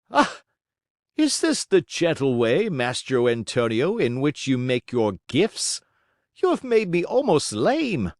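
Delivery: high, pained reproach; hurt and indignant. A narrator's reading. Struck Geppetto scolds the carpenter, high and pained. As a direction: cry out, then scold. Wood hits Geppetto's legs.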